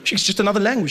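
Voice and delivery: ordinary talk, nothing marked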